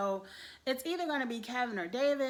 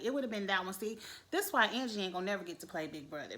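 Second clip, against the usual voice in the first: ordinary talk, nothing marked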